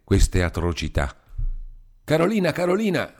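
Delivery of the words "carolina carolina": high, urgent calling of his sister's name. A narrator's reading. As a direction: shout her name up the house in alarm. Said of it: "disse entrando in casa".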